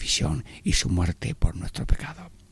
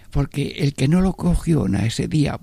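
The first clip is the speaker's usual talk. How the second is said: usual speaking voice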